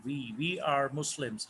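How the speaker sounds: slow, deliberate "we" with a full long vowel, not "वो" or "ही"; clear we. Shown as a demonstration. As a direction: say "we" slowly and carefully for learners. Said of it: "वी पढ़ना है".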